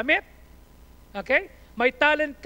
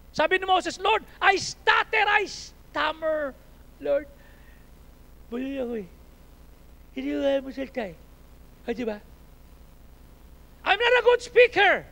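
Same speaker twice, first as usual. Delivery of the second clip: full-force preaching; 6 semitones higher, 9 dB louder than usual. Acted. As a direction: stretch out the stammering excuse.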